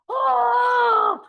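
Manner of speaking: high, loud burst of shock; a loud, high "Oh!" from the whole group; shocked gasp. Retold or imitated. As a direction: cry out in surprise. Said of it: "Everyone's like".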